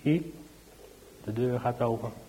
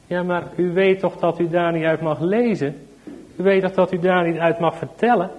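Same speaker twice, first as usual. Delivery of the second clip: stern policeman; 5 semitones higher, 6 dB louder than usual. Acted. Police scold the mother.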